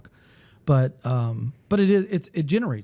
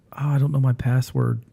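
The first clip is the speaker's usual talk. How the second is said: normal talking voice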